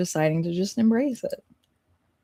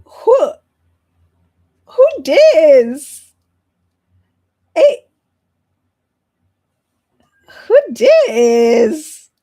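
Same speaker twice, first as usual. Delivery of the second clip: high, loud squealing who dis; excited yelling; 9 semitones higher, 11 dB louder, much slower than usual. Real, not acted. Player shrieking at a handsome stranger, loud and high. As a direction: shriek it out in giddy shock.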